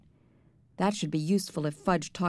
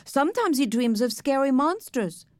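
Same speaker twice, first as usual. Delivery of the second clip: put-on higher-pitched small child; little boy Fudge; 6 semitones higher, 3 dB louder than usual. A narrator's reading. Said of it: "Fudge said".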